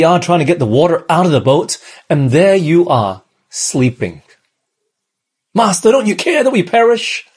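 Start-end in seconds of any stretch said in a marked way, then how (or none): 5.5-7.2 high, desperate cry to the master; mock panic, pitched high; frightened cry. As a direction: shout it up over the storm. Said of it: "they were madly scared".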